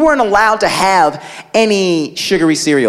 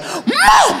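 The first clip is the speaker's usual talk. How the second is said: put-on much higher whiny kid outburst; little brother snapping at Mom, much higher. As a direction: blurt it like a fed-up child. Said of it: "He was just like".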